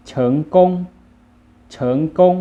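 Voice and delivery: เฉิงกง as loud Chinese, rising to high; Chinese pronunciation. Shown as a demonstration. Teacher models the word for success, Chinese pronunciation of เฉิงกง. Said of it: "ภาษาจีนจะว่า".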